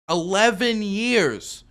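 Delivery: "Eleven years" dragged out slowly, each word leaned on; slow emphasis. Real, not acted. Hammering the eleven-year delay with slow emphasis.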